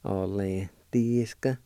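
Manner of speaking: "Atlantiska" said slowly and clearly. Shown as a demonstration. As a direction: model "Atlantiska" at a slow pace. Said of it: "like this".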